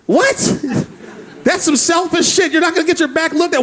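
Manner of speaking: a high shocked squawk before the scolding; incredulous. Acted. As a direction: act stunned by her answer. Incredulous reaction to grandma.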